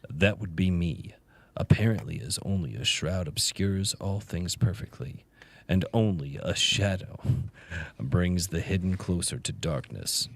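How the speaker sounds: low, slow, portentous reading; super deep voice. Pretend. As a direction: intone it deep and solemn. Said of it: "super deep voice".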